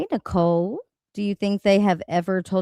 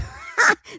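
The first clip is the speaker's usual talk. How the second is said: breathy laugh; 13 semitones higher than usual. Real, not acted. Laughing at own insult.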